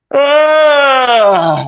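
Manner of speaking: big, loud, drawn-out yawn; high, loud staged yawn; demonstration yawn. Shown as a demonstration. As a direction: let out a huge open-mouthed yawn on purpose. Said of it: "El experimento es sencillamente este".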